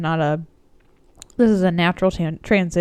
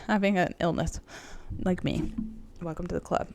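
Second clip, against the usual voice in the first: normal talking voice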